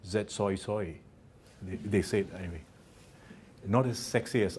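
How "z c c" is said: put-on a light Australian accent, "soy soy" with a wide oy vowel; mock Australian accent. New Zealand lecturer teasing Australian counterparts, light mock Australian accent. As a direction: lightly imitate Australian colleagues saying "soy soy". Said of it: "Or as my Australian counterparts would say".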